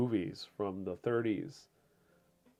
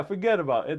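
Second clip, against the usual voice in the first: loud, raised-pitch New York dismissal; New York accent; 5 semitones higher, 6 dB louder, faster than usual. Acted. Streamer repeating the New Yorkers' catchphrase, emphatic New York accent. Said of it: "I think New Yorkers said it best".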